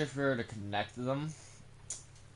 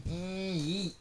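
high yeet; 8 semitones higher than usual. Pretend. Launching truck into pond.

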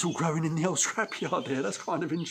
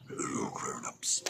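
a stage whisper, slow and hushed; 7 dB quieter, slower than usual. Real, not acted. Vlogger whispering a joke to his viewers, hushed and slow. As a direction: breathe it like a secret aside.

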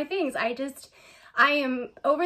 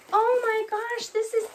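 much higher, breathless delight; high excited gasp; 10 semitones higher than usual. Real, not acted. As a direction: keep the thrilled squeal going. Still overwhelmed by the bag.

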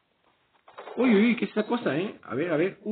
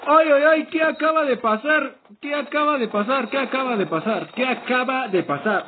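startled shout; 5 semitones higher than usual. Real, not acted. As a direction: blurt it out in alarm. Shocked after sudden in-game attack.

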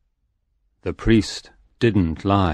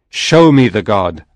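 louder commanding cry; cried demand; 7 dB louder than usual. A narrator's reading. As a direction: shout the order at the priest. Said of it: "I cried".

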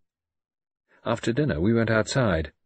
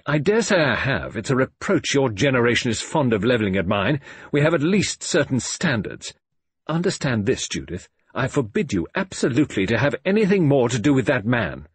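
high, loud, emphatic scolding; angry father; 5 semitones higher, 5 dB louder than usual. A narrator's reading. Father forbidding his daughter that man, angrily. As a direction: forbid it absolutely, voice raised.

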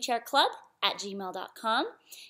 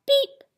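high, punchy little horn; truck beep; 12 semitones higher, 6 dB louder than usual. A narrator's reading. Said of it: "Blue said".

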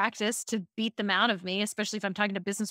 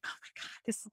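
hushed, whispered awe; retold starstruck whisper; mind blown; 15 dB quieter, faster than usual. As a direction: gasp it under your breath, acting amazed. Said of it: "my mind is, like, blown".

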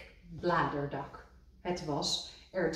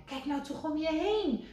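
high, excited; enthusing; 10 semitones higher than usual. A narrator's reading. Rad showing Kikker the land, high and excited. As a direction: marvel at the view.

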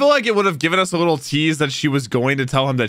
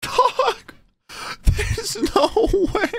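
disbelief; slower than usual. Real, not acted. Viewer after the crash, stunned and disbelieving.

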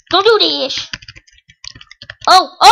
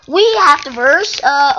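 ordinary talk

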